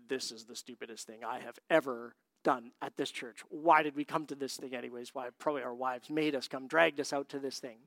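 low, soft grumble under the breath; sulky muttered complaint; grumbling. Retold or imitated. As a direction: mutter like a dragged-along husband. Two reluctant men grumbling at church.

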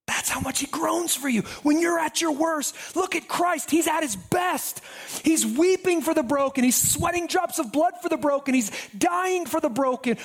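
high, ringing preacher's climax; preaching at full force. Acted. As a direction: lift the congregation with rising fervour. Preaching climax, raised pitch.

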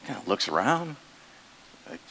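normal talking voice

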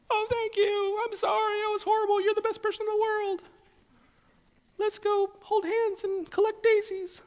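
very high, falsetto gushing apology; mock gushing reply. Pastor mocking a forgiven person's gushing reply, high falsetto. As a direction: ham up a sappy over-the-top thank-you.

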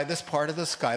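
ordinary talk, nothing marked

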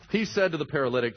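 ordinary delivery

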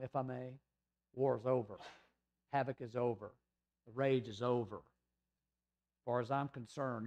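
softly spoken, gentle and unhurried comfort; quiet, tender voicing of Jesus; tender reassurance. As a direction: soothe her like a loving father. Jesus comforting the healed woman.

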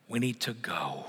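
whisper. Real, not acted. Sermon climax drops to whisper.

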